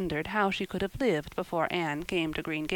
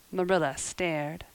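ordinary delivery

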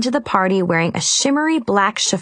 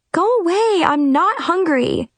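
high, whiny spoiled grump; grumpy; 11 semitones higher than usual. A narrator's reading. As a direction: whine like a spoiled toddler. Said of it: "I grumped".

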